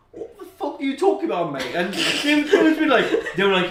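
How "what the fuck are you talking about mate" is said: incredulous. Retold or imitated. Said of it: "and I'd be like".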